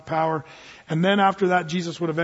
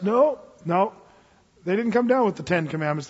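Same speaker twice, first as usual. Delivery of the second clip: ordinary talk, nothing marked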